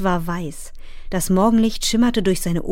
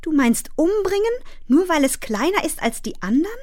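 put-on much higher, appalled child's voice; horrified outcry; 9 semitones higher than usual. A narrator's reading. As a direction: protest in shocked disbelief, pitch leaping up. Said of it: "schrie Fern entsetzt". (entsetzter, hoher Aufschrei eines Kindes)

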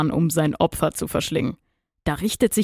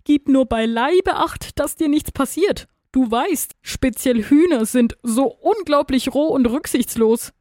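high-pitched, fretful warning; anxious; 8 semitones higher than usual. A narrator's reading. Worm's wife warns her husband about rough hens, anxious. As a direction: plead with him to take care. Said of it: "ängstigte sich die Regenwürmin".

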